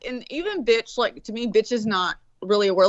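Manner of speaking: ordinary talk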